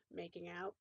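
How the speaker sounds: plain reading voice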